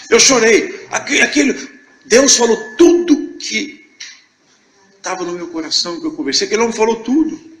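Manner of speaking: overcome, half crying half laughing; voice breaking. Real, not acted. Testifying to the brothers, overcome with tears and laughter. Weeping while retelling God's message.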